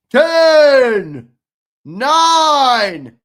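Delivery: loud excited countdown; hyped countdown. Real, not acted. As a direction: count down like the crowd is with you.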